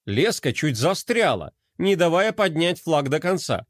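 ordinary delivery

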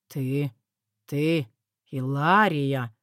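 low, slow, halting amazement; falter, then draw out her name; breathed admiration. A narrator's reading. Husband suddenly admires his scheming wife, awestruck. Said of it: "выдохнул он во внезапном восхищении".

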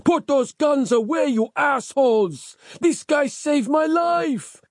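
high, indignant order slowing to emphatic plea; yelling. A narrator's reading. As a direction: holler at the gunmen, stretching the ending. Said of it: "yelled Capello".

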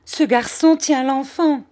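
high, loud horrified cry; alarmed cry. A narrator's reading. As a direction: shout it out, scandalised. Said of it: "criait Miss Murdstone". (cri horrifié, voix haut perchée)

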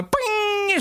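high, ringing "ping" noise; sustained high-pitched sound effect; imitated ping. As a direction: sing out a long high ping.

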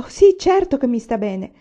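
barely able to get the words out for joy; Laura accepting the teaching post, breathless joy; overjoyed. A narrator's reading. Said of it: "riuscì a rispondere a stento".

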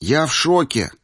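high-pitched, excited amazement; amazed admiration. A narrator's reading. Friend marvelling at the winner by the changing room, amazed admiration. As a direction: gush in awe to your friend. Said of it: "восхитился Ираклий".

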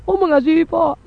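congregant brightly insisting "Oh, mengasihi"; keen believer assuring the pastor; eager congregant. Retold or imitated. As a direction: play the churchgoer quick to say yes. Congregant answers the preacher.

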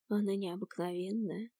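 soft, dreamy character voice. A narrator's reading. Professor tells a friend about his beloved, dreamy and hushed. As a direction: say it quietly, lost in fond thought. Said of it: "мечтательно улыбнулся профессор".

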